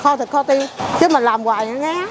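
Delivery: ordinary talk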